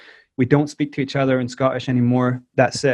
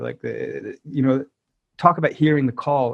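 ordinary delivery

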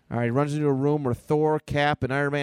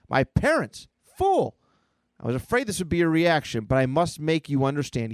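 high, theatrical defiance; melodramatic boy; 3 semitones higher than usual. Acted. Boy scorns Iron Man, hammed-up high defiance. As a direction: scoff with a high spike, then declaim. Voices defiant, dramatic kid.

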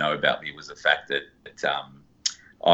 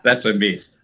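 laughing; 8 semitones higher than usual. Real, not acted. Guest laughingly denies.

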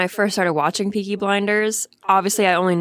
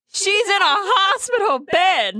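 incredulous; 8 semitones higher than usual. Real, not acted. Incredulous about the scene.

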